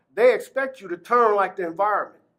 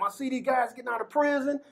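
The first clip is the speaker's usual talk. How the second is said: ordinary delivery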